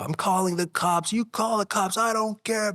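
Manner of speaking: roommate yelling. Retold or imitated. Roommate yelling in violent fight.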